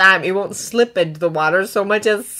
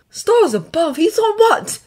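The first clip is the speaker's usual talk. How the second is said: high, louder astonished exclamation; exclaim, climbing higher into the question; shocked disbelief; 10 semitones higher, 4 dB louder than usual. A narrator's reading. Dragon hearing what the boy witnessed, shocked.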